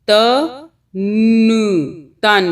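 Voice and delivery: "त", "न", then blended "तन"; sounding out. Shown as a demonstration. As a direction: break it into sounds, then join them. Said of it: "इसी तरह ता है". ("त" "न" फिर "तन")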